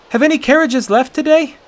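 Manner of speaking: high, loud, urgent demand; boy demands answers from the gate guards. A narrator's reading. Boy pressing the guards, loud and high. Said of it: "he demanded".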